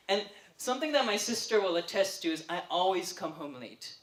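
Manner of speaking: usual speaking voice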